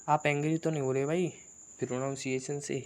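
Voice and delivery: ordinary talk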